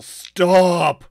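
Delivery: huge, drawn-out bellow; full yell. Acted. Dwarf commanding the bolting horse, very loud high yell. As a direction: bellow the command with all your power. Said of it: "And I yell".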